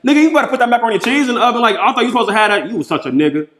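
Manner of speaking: high, fast, loud mock scolding of a daughter; sister scolding her daughter. Imitating her sister fussing at her daughter about the macaroni, high and fast. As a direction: rattle it off like a big sister fussing at a kid.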